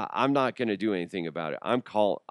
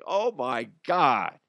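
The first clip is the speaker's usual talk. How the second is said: exasperated dad; 4 semitones higher than usual. Retold or imitated. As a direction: drag it out, fed up. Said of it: "I'm like".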